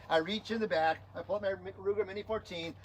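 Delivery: ordinary talk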